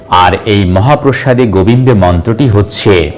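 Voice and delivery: usual speaking voice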